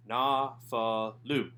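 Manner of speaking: Na, fa, lu split into open syllables. Shown as a demonstration. Teacher breaks the word into syllables for students, each one separate. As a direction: spell it out syllable by syllable. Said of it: "as an open syllable".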